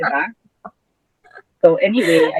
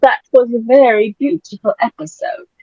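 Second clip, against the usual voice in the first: higher-pitched put-on British accent; mock British accent; 4 semitones higher than usual. Host praising the episode, playfully attempted British accent. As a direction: play at being British. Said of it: "Paano ba British accent?".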